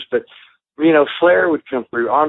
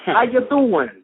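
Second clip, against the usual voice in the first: mock New York accent, pitched higher; a playful New York greeting; a light, hammed-up New York accent; 8 semitones higher than usual. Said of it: "New York accent".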